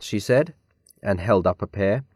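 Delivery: ordinary delivery, nothing marked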